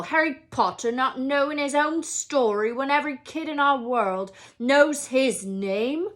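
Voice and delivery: loud, indignant giant's rant; angry outburst. A narrator's reading. Hagrid to the Dursleys about Harry's parents, loud and furious. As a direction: rage at the Dursleys for the lie. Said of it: "jumping up so angrily".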